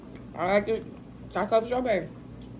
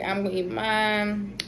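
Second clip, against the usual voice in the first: ordinary delivery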